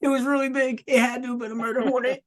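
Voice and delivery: high-pitched mocking squeal; pleading mock falsetto; mocking impression. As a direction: mimic your friend in a silly, very high voice. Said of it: "He's like".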